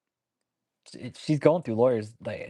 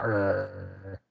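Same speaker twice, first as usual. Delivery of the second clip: mock growl. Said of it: "She growls at me".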